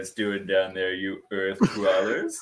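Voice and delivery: snooty giraffe voice. Acted. Giraffe talking down to humans.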